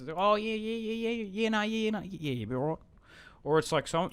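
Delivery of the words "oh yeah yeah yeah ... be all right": much higher broad bogan Aussie babble; broad Australian mock rambling; thick bogan Australian. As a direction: yeah nah yeah in a thick bogan Australian accent. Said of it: "my accent gets, like, really thick and bogan".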